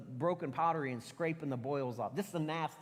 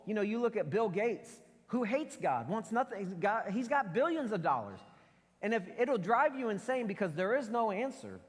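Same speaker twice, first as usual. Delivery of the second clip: usual speaking voice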